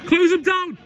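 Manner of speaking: loud shout at the screen. Real, not acted. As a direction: yell it at the players. Fan yelling at match on screen.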